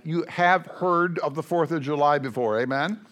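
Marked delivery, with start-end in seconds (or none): none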